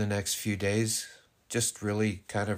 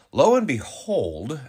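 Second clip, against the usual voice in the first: slow, loud, high-swooping flourish; dramatic reveal; 7 semitones higher, 8 dB louder, slower than usual. Real, not acted. Partner telling of brother's wedding, dramatic reveal. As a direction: build up the big coincidence. Storyteller sets up the coincidence.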